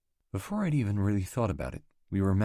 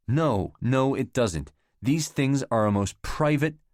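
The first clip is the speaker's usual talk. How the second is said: louder, higher, slow and emphatic; earnest, raised protest; insistent; 8 semitones higher, 6 dB louder, slower than usual. Acted. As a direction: press each word home. Heated insistence in the quarrel.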